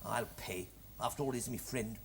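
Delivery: very low, hushed reluctant murmur; small, soft, grudging concession; quiet giving in. Acted. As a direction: give in under your breath. Grudgingly agrees to pay for friend.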